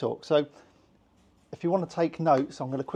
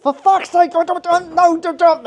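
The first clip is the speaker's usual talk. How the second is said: much higher and louder, exploding in frustration; furious outburst; 12 dB louder than usual. Retold or imitated. Losing it in a heated row at work, furious shout. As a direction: blow up at them mid-argument, shouting.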